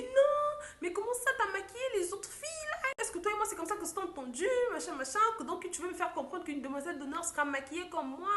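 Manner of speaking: high, loud furious yelling at the makeup artist; angry bride yelling. Retold or imitated. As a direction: shout it like an outraged bride. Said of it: "Elle était en train de crier". (mariée hurlant de colère, voix aiguë)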